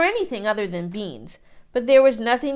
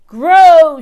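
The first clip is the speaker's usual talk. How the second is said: much louder booming order; shouted command; 7 semitones higher, 16 dB louder than usual. A narrator's reading. Giant shouts at the seeds to grow. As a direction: bellow at the garden. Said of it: "shouted the giant".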